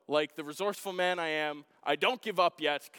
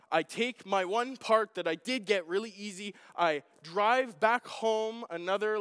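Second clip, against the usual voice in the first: usual speaking voice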